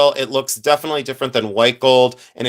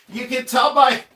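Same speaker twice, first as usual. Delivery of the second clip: laughing; 10 semitones higher than usual. Real, not acted. Laughing at a chat joke.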